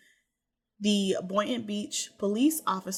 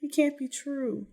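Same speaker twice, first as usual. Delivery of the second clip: higher, mild mock distress; raised-pitch retelling of a wail; retold wailing; 6 semitones higher than usual. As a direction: imitate an upset wife, without real sobs. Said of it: "Dahlia starts wailing and sobbing, and she's just so upset".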